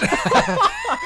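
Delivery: high, loud burst of laughter; big laugh. Real, not acted. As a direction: let out a hearty laugh. Laughs at own joke.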